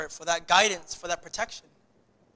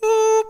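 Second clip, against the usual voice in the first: imitated chime; 8 dB louder than usual.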